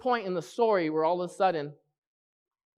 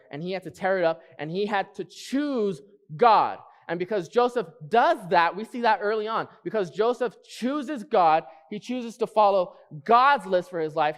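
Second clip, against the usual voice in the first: raised, driving sermon voice; fervent pulpit delivery; preaching; 4 semitones higher, 3 dB louder than usual. Acted. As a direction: preach it out to the congregation. Preaching builds up.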